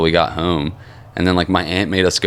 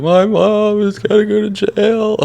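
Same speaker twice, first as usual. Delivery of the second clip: put-on high, drawn-out childish wail; mock bawling; 4 dB louder, slower than usual. Second grader bawling in class over mom, mock wail. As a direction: wail it slowly as a blubbering kid. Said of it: "bawling in Miss Walden's second grade class".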